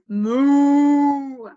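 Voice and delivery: slow, drawn-out, loud moo; mooing cow. Pretend. Teacher to toddlers, a slow, loud cow moo. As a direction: stretch the moo like a lazy cow.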